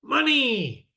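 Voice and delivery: high, loud cry of Money!; mock greedy cry. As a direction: burst out like a money-mad miser. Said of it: "people get attached to is money".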